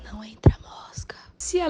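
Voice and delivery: menacing whispered warning. Acted. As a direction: breathe it with no voice. Menacing aside to Chico.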